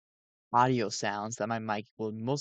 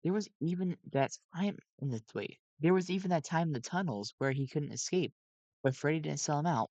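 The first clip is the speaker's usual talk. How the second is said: usual speaking voice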